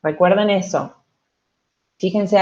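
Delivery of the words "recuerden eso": normal talking voice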